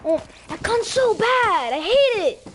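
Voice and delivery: high, loud gamer rage at a bad gun; frustrated yell. Real, not acted. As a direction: scream it out, furious with the weapon.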